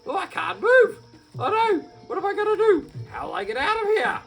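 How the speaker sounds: high, loud, fast panic; panicked creature. Acted. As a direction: Kunchiel stuck to the sap, high panicked cries.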